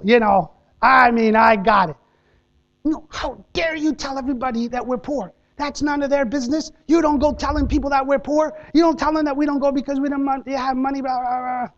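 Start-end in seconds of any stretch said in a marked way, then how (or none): 2.8-11.7 higher-pitched mock scolding, mildly voiced; a hint of a mother's telling-off; mom scolding. Mom scolds her son for telling everyone they're poor, mock scolding. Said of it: "I get a mouthful".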